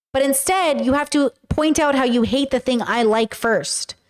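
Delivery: ordinary talk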